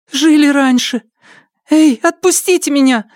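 put-on girl pleading to be released; alarmed girl. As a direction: protest in a high, anxious voice. Frightened girl protests being grabbed.

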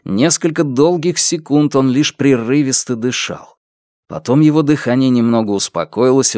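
usual speaking voice

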